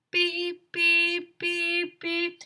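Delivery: high, loud mock alarm beeps; high, even electronic beeps; imitated beeping. A narrator's reading. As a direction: beep like a smoke detector. Smoke detector keeps beeping.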